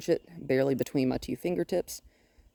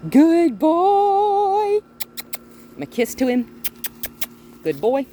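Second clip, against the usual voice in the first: high excited praise; 12 semitones higher, 8 dB louder, much slower than usual. Real, not acted.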